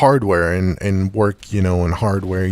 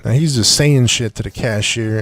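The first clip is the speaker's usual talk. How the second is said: ordinary delivery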